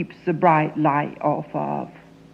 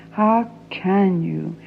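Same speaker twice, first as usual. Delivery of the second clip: slow; slower than usual. Acted. Pleading with father.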